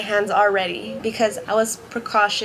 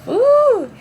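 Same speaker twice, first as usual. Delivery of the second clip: tense exhale; 14 semitones higher, 7 dB louder than usual. Retold or imitated.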